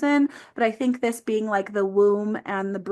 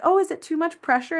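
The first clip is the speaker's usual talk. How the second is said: higher-pitched checking-in question; quoting herself to clients; 7 semitones higher than usual. Retold or imitated. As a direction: ask it lightly, pitched up. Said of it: "I'm like".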